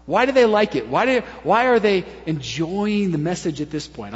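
ordinary delivery

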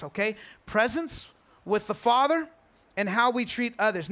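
ordinary talk

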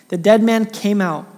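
ordinary delivery

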